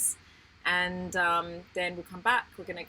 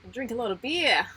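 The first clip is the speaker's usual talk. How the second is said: hammed-up Australian accent, a bit louder; exaggerated Australian accent; 5 semitones higher, 5 dB louder than usual. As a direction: play up a broad Australian accent, jokingly. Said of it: "that's a really terrible Australian accent".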